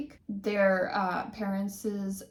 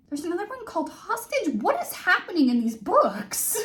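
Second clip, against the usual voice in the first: much higher and louder disbelief; can't believe these titles; incredulous; 9 semitones higher, 6 dB louder than usual. Real, not acted. Reacting to the book titles with loud disbelief.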